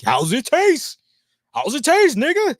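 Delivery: high, loud mocking taunt. Mocking a pizza commercial's zoom-in, loud, high taunt. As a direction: ham up the leering camera, loud and high.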